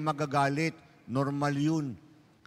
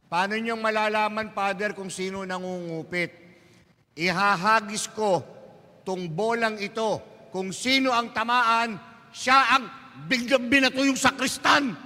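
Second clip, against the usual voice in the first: higher, louder eager voice building to a shout; excited sacristan; 5 semitones higher, 3 dB louder than usual. Acted. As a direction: get more worked up toward the throw. Sacristan explaining the ball trick. (nasasabik na sakristan, palakas nang palakas)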